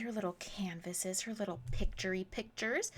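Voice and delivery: a little secret whisper to the viewers; playful whisper. Real, not acted. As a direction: whisper it, soft and cute. Cute aside about tiny canvases.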